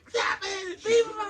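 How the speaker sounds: very high, frantic shout, near crying; imitating a frantic girlfriend shrieking; shrieking woman. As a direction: scream at them to stop fighting. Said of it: "the lady went".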